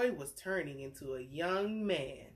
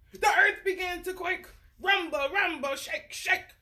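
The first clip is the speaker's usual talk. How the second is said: dramatic telling; 9 semitones higher, 4 dB louder than usual. A narrator's reading. Dramatic story reading.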